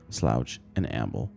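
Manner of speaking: plain reading voice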